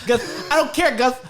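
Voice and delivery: hammed-up brush-off; comic high voice. Friend quoting the bit, high mock shout.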